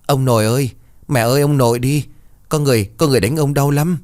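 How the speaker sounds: mildly acted sobbing plea of a child; whimpering child. A narrator's reading. Boy telling his mother grandfather is being hurt, whimpering. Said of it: "miệng của nó khóc lóc mếu máo".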